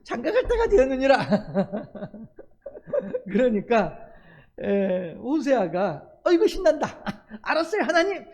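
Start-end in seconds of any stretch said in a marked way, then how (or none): 0.0-1.2 the line broken by real laughter; giggling through God's words; laughing repeat. Real, not acted. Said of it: "laughs while repeating". 6.2-8.2 higher, delighted, laughing a little; gleeful young prophet with a chuckle; excited Hosea. Acted. Hosea happily agrees to marry.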